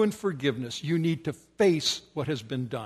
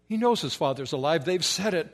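ordinary talk

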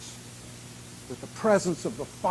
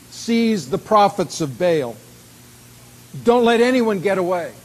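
loud, deliberate order, pitch rising; prophet's command; 4 semitones higher, 8 dB louder than usual. Acted. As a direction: command the crowd forcefully. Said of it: "Elijah commanded them".

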